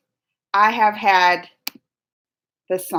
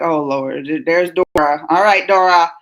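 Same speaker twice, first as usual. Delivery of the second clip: much lower, tired eye-rolling voice; weary groan; 8 semitones lower, 4 dB louder than usual. Retold or imitated. Vlogger voices a viewer fed up with corny Dora, low weary tone. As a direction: sigh it out like you're fed up with Dora. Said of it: "where you're just like".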